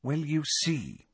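gently patronising patience; patient explaining. A narrator's reading. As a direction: explain slowly, as to an over-emotional toddler. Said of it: "with the patient air".

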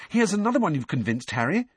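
excited, high and quick; Hermione. A narrator's reading. Girl announcing a supportive letter, excited. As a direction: bubble over with the good news. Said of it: "said Hermione excitedly".

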